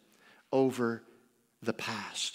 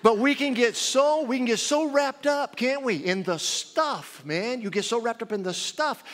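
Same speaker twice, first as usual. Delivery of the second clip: preaching at full force; 10 semitones higher, 8 dB louder than usual. Acted. Preacher rising to a peak.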